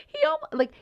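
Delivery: ordinary talk, nothing marked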